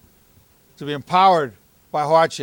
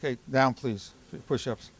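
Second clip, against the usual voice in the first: usual speaking voice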